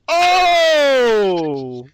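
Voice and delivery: high loud Oh at his own pun; delighted shout. Real, not acted. Host cracking a corn pun, delighted shout. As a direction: shout it out, thrilled.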